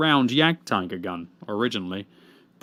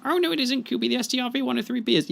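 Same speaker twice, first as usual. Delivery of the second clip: high, fast mock voice; mock correction; 12 semitones higher, faster than usual. Correcting his own claim to Kubi, squeaky know-it-all voice. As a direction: jump in like a know-it-all heckler.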